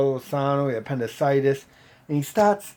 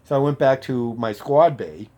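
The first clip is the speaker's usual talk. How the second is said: ordinary delivery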